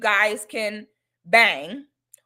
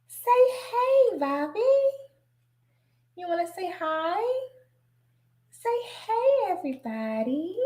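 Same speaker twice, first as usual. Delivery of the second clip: sweet high sing-song; 8 semitones higher than usual. Real, not acted. Coaxes dog to greet viewers.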